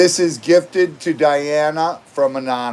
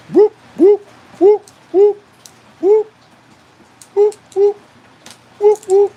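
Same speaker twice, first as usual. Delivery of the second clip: siren whoop; 5 dB louder than usual. Acted. Celebrating the end of the countdown.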